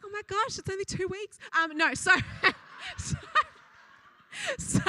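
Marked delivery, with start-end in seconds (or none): 0.0-1.4 high-pitched mock alarm; high, flustered pretend protest; mock shock. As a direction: play at being scandalised by a slip. Imagined reaction to a leaked secret.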